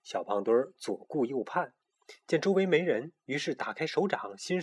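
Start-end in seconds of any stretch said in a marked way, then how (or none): none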